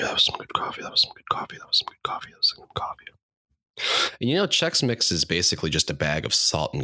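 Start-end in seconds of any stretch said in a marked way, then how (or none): 0.0-1.6 whispered. Shown as a demonstration. Repeating his coffee line in a whisper. 1.6-3.0 barely there whisper, trailing away; fading whisper. Shown as a demonstration. Repeating his coffee line, fading to a whisper.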